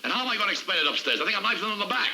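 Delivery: high-pitched, harried objection; agitated protest. Acted. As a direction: argue back, pitch riding high. Boss protests in acted quarrel.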